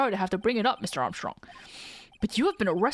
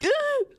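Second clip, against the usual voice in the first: sharp high gasp; shocked gasp. Acted. Accused chef reacts.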